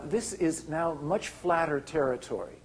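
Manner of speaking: ordinary delivery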